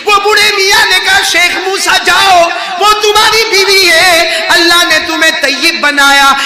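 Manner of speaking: preaching. Acted. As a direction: drive the command home to the crowd. Preacher at full force. (پورے زور سے وعظ)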